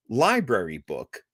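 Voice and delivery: ordinary talk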